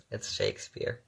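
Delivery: ordinary delivery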